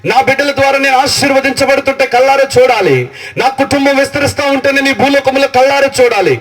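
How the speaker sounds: high, ringing preacher's cry; full-force preaching. Acted. Pastor urging the congregation toward God's future, full-force preaching. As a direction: lift the congregation with fervent preaching.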